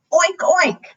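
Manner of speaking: high-pitched storybook oinks; pig oink. Acted. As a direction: imitate a pig for children. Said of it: "grunted the pig".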